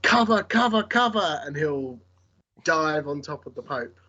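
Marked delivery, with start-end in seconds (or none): 0.0-1.4 high, urgent shout for cover; shouted warning. Acted. Player voices a character diving to protect the Pope, shouting. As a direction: yell it out sharply, pitched high. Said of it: "he'll just shout".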